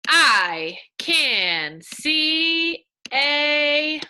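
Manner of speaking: stretched, word-by-word reading; slow reading aloud. Shown as a demonstration. Teacher modeling slow reading for kids. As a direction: read it out slowly and clearly. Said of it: "let's go to the next one".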